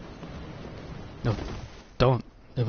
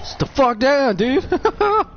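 high, louder shout at the screen; yelled command; 13 semitones higher, 4 dB louder, much slower than usual. Real, not acted. Gamer shouting at an enemy to sit down, high and loud. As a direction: bark it at the enemy, pitch flying up.

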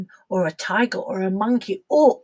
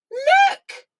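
excited; 4 dB louder than usual. Acted. Spots the elephant.

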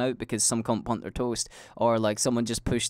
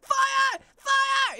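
very high mock yell of fire; little brother raising the alarm; retold shout. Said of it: "he starts shouting".

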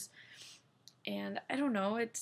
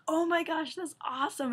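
high, loud delighted exclaim; thrilled oh my gosh; excited; 7 semitones higher, 4 dB louder than usual. Retold or imitated. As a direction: gush with delight. Husband reacting to her score.

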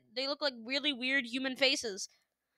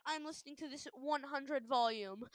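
usual speaking voice

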